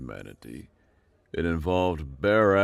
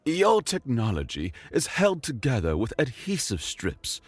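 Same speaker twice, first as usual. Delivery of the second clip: mocking alien counselor; 8 semitones higher than usual. A narrator's reading. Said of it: "another counselor chimed in".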